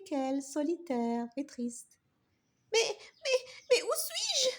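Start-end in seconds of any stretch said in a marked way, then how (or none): 2.7-4.5 high, shaky stammer with nervous laugh; stammering, lost. Acted. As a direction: stammer it out, bewildered. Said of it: "balbutia-t-elle". (balbutiement perdu et aigu)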